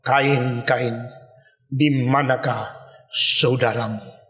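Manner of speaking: low, soft, slow voice of God calling; God calling Cain. Acted. God searching for Cain after the killing, low and slow. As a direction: let God's question sink low and slow, almost sorrowful.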